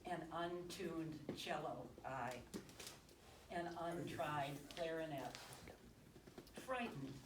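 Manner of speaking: slow, measured poem recital with long pauses; poetry reading. Acted. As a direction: linger on each short phrase, pausing between them. Reads own love poem aloud.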